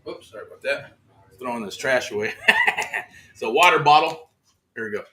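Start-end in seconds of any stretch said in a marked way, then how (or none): 2.4-3.0 short laugh. Real, not acted. Laughs while tossing trash.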